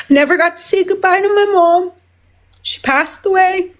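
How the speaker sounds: choked up with real grief; real emotional, near tears. Real, not acted. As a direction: hold back tears through the loss. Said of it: "excuse me while I get emotional".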